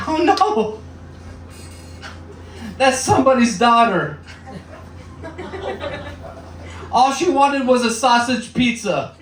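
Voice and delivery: high, squeaky, scandalised wail; mock outrage. Comedian squealing in fake horror at a video. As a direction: clutch your pearls in a high voice.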